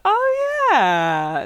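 high squeaky drawn-out "Oh"; Homer voice. Retold or imitated. Homer recalling Ned on the phone, high squeal. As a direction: squeal the oh like a dopey realisation. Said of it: "He's like".

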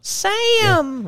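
high, loud falsetto shout of the name; hammed-up high holler; fan squeal.

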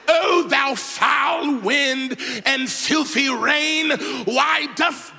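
booming theatrical King James command; dramatic movie Jesus. Pretend. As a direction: ham it up like an epic film Jesus. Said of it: "sometimes we think Jesus is real dramatic".

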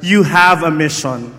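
loud, punchy call to the neighbour; loud repeat-after-me. Shown as a demonstration. Preacher tells the crowd to say it to their neighbour, loudly. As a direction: say it out loud to the person beside you.

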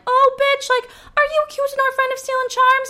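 high, loud sassy friends. Retold or imitated. Friends defending the thief with high, loud sass. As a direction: jump to her defence with sass. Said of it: "her friends are like".

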